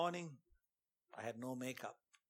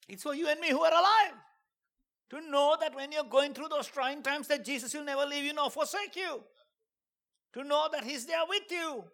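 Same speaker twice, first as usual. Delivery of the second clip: much higher, loud preaching climb; preaching at full force; 11 semitones higher, 9 dB louder than usual. Acted. Telling the people of God he is with them, full-force preaching. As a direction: preach it out to the congregation.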